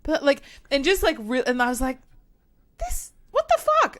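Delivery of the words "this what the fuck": very high, loud incredulous squawk; outraged disbelief. Retold or imitated. Friend watching yet another recognition, exasperated disbelief. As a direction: blurt it in exaggerated indignation.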